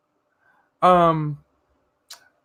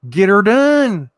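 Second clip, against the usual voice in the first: louder, higher, stretched-out catchphrase; emphatic rallying cry; 4 semitones higher, 7 dB louder, slower than usual. Shown as a demonstration. Rallying a viewer to get her done, loud drawn-out holler.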